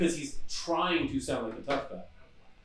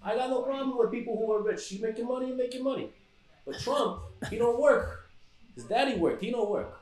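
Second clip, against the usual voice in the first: put-on higher, softer old Brooklyn Italian accent; old-time Brooklyn wiseguy voice, pitched up; old Brooklyn gangster; 5 semitones higher, 5 dB quieter than usual. Shown as a demonstration. Host griping about a rich man's son in an old Brooklyn gangster accent. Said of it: "You hear the voice I'm using right now".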